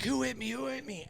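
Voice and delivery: groggy and lost, mumbling upward; mock dazed murmur; dazed drunk guy. Voices the dazed attacker.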